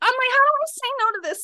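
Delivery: very high, strained panic; panicked inner voice. Retold or imitated. Facing a hug from a stranger, very high panicked squeak. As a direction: climb way up in pitch, cornered. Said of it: "I'm like".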